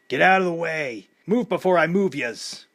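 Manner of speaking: rough shouted warning; growling. A narrator's reading. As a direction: shove a path through the crowd with your voice. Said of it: "Paulie growled".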